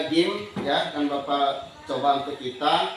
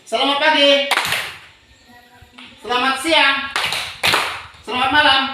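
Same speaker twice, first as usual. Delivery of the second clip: high, loud classroom cues; called greetings; 9 semitones higher, 6 dB louder than usual. Shown as a demonstration. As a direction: call each greeting out to the class. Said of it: "sekali lagi". (salam diserukan keras kepada kelas)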